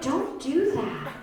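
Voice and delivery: high, drawn-out motherly warning; admonishing mom. Acted. Mom scolding in acted exchange.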